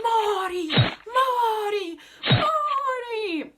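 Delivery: very high, loud, held screams; hammed-up opera heroine screaming as she stabs; operatic death shriek. Acts Tosca stabbing him.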